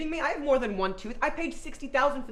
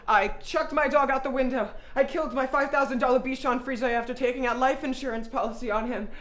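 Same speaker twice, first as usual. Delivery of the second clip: normal talking voice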